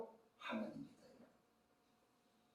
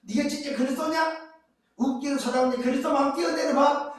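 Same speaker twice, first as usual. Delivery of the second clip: lightly acted jeer, pitched higher; sarcastic taunt; 10 semitones higher, 3 dB louder than usual. Devil daring Jesus to jump, mocking sarcastic taunt. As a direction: goad them with mock disbelief. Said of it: "비꼬는 거죠".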